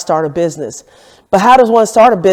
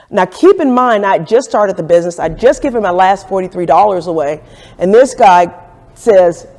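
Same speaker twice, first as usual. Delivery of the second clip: usual speaking voice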